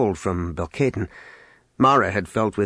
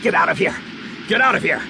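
raised, higher-pitched urgent shout; urgent cry; 5 semitones higher, faster than usual. A narrator's reading. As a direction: cry it out to everyone on the channel. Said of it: "Han cried through all channels".

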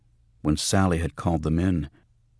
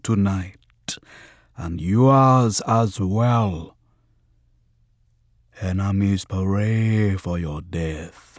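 slow, booming, higher prophetic voice; possessed voice; 4 semitones higher, 6 dB louder, much slower than usual. A narrator's reading. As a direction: intone it like a spirit prophesying. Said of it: "deep and slow and weirdly glutinous".

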